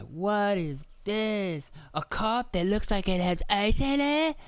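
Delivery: put-on high, drawn-out toddler wonder; exaggerated slow squeaky kid amazement; amazed little kids. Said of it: "even just everyday life, they're just like".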